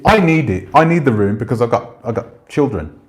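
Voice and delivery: ordinary delivery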